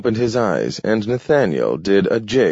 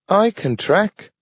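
much higher eager dog voice; a little dog offering to help, pitched up; Nathaniel the dog; 14 semitones higher than usual. A narrator's reading. Dog Nathaniel begging Grant to take him, high dog voice. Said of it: "Nathaniel told him".

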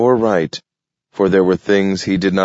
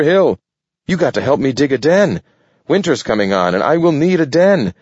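higher, fast, desperate raccoon; frantic raccoon plea; 6 semitones higher, faster than usual. Acted. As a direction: climb higher and faster, pleading a friend not to leave. Archie's escalating plea.

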